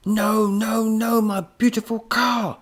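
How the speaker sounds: high, loud drawn-out wail of dismay; anguished cry. A narrator's reading. Professor watching robot wreck his car, anguished cry. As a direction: wail it loudly, stretching the words. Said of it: "cried the professor".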